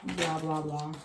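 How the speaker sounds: ordinary delivery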